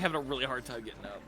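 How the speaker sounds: ordinary talk, nothing marked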